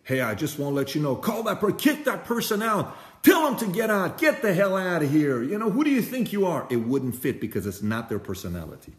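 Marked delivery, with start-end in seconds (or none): 0.0-6.7 high, shrill-free yelling from a saintly figure turned furious; mock furious nun shouting; angry Mother Teresa. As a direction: throw people out in a raised, much higher voice. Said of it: "a Mother Teresa to get up and say".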